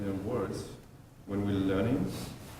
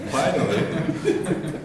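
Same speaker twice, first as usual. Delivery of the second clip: laughing; 6 semitones higher, 7 dB louder, much slower than usual. Real, not acted. Relieved after struggling word.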